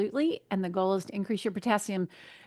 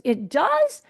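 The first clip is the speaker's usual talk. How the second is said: high, louder surprised question; retold surprise; 8 semitones higher, 5 dB louder than usual. Imitating a traveler told about potassium, surprised. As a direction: imitate someone pleasantly astonished.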